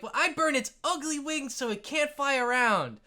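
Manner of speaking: put-on high-pitched child declaring an attack; very high kid voice; kid player. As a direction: play an eager kid at the game table. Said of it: "the other kid is like".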